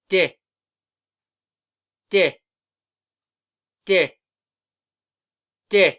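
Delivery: D as a short, clipped "duh" sound; letter sound of D. Shown as a demonstration. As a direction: say the bare sound of D, crisply. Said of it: "make these letter sounds together".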